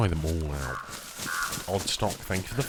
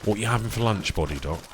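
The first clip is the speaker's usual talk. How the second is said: normal talking voice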